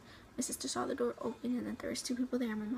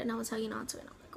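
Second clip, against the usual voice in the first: usual speaking voice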